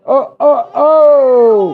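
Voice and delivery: high, loud oh oh oh; reacting to an aggressive face; mock alarm. Real, not acted. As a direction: exclaim in alarm.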